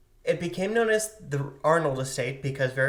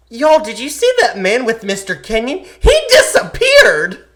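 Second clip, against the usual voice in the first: high, loud shriek climbing to a wail; excited child shrieking; 9 semitones higher, 15 dB louder than usual. Acted. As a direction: burst in yelling, stretch the last word out. Acted child bursts in, startled.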